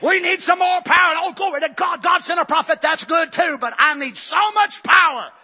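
very high, loud shouted praise; preaching at full force; ecstatic preaching. Real, not acted.

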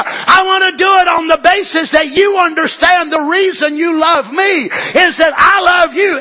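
loud, driving sermon, pitch climbing; preaching at full force. Acted. Preacher pressing the congregation on God's love, loud and driving.

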